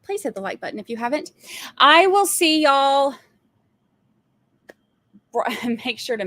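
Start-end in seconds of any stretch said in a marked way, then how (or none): 1.8-3.1 drawn-out sign-off. Real, not acted. Signing off drawn out.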